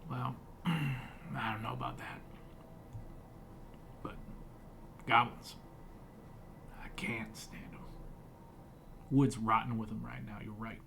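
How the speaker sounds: a hint of a gruff woodsman; gruff hermit.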